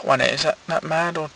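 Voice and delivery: ordinary delivery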